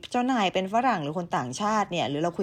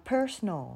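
slow "personal" with PER stressed; stress on first syllable; 3 semitones higher, much slower than usual. Shown as a demonstration. Teacher reading out the word, "personal" stressed on first syllable. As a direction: lean on PER, let the rest of "personal" drop. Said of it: "เราจะเน้นเสียงที่".

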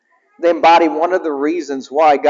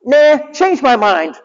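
high smug refusal; cocky hedgehog brushing them off; high smug hedgehog hero; Sonic's voice; 8 semitones higher, slower than usual. Retold or imitated. Sonic's punchline.

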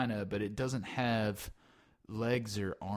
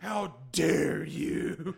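mock offended; 5 semitones higher, much slower than usual. Host offended.

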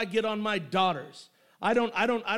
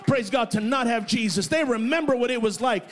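loud, climbing shouted preaching; full-throated pulpit crescendo; preaching at full force; 3 semitones higher, 6 dB louder than usual. Acted. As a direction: lift the room with a rising shout.

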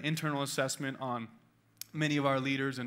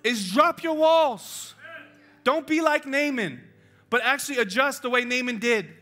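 high, loud preacher's call; full-force preaching; 8 semitones higher, 10 dB louder than usual. Acted. Pastor exhorting the men in the room, loud and high. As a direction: drive it home to the congregation.

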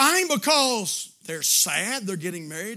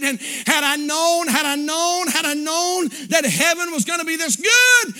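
impassioned preaching; 9 semitones higher, 8 dB louder than usual. Acted. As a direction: preach it hard, building to a shout on the last word.

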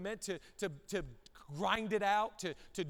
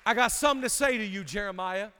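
loud preaching; 8 dB louder than usual. Real, not acted. Preacher shouting message.

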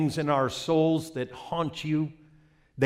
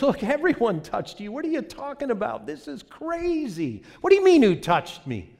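exasperated disciple; 12 semitones higher, 8 dB louder than usual. Acted. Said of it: "the disciple looks at Jesus".

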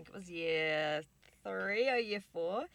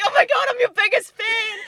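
much higher and louder starstruck squeal; fangirl squeal; 19 dB louder than usual. Retold or imitated. Girl meets her TV idol in the elevator, squealing. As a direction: gush at her like a starstruck kid. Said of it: "I was like".

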